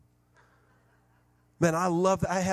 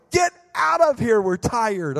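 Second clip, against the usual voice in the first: grumpy tired family; 8 semitones higher, 7 dB louder, slower than usual. Retold or imitated. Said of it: "They're like".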